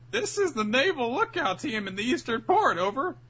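high-pitched mock lookout reporting in; fake official report. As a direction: put on a high, overeager official voice. Pirate fakes an official radio report.

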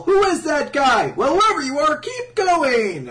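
high, loud mock cheering; root for an underdog at full pitch; cheering crowd. Crowd rooting for the stranger beating Doflamingo, high excited shouting. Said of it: "Everyone's, like, rooting for him".

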